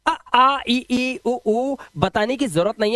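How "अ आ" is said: loud classroom vowel drill; vowel sounds demo. Shown as a demonstration. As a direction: call out अ and आ loudly and clearly. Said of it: "आइए ना, पहचानते हैं".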